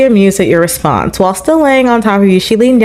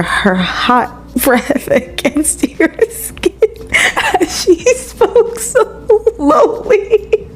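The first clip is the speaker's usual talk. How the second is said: high, slow, squeaky with giggles; reading through laughter; 10 semitones higher, 4 dB quieter, much slower than usual. Real, not acted. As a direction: struggle to get the words out while laughing. Reader cracks up while narrating romance.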